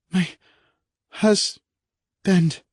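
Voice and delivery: high, anguished woman calling for her husband; a wife frightened for him; distraught wife. Acted. Kay voiced in distress.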